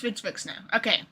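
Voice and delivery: ordinary delivery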